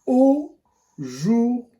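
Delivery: aujourd'hui drawn out very slowly; slow teaching word. Shown as a demonstration. A teacher showing the class aujourd'hui, very slowly. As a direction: stretch aujourd'hui syllable by syllable. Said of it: "Le premier mot outil".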